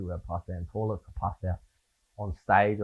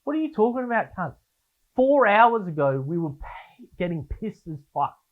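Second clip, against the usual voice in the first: high, louder mock disbelief from the stranger; incredulous partygoer; 9 semitones higher, 4 dB louder than usual. Stranger reminds him they drank for hours, high mock disbelief. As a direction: play the guy who can't believe you forgot. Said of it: "the guy's like".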